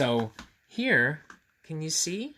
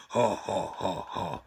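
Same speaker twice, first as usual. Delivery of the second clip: mock monster laugh; 6 semitones lower, slower than usual. A narrator's reading. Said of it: "the other monsters laughed".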